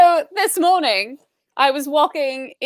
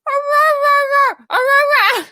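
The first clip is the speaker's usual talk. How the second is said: imitated husky barking; 9 semitones higher, slower than usual. Imitating the husky peeking under the fence, high barks. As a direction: bark like the stuck husky. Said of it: "It was like".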